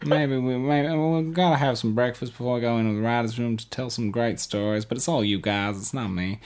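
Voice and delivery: lightly voiced, soft goofy teen impression; Vince Gilligan as Walt Junior. Host mocking Walt Junior as writer: light quiet goofy impression. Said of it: "Vince Gilligan as Walt Junior".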